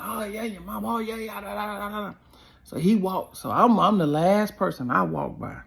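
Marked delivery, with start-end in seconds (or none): 0.0-2.1 imitating heckler. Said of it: "He yelling out the window".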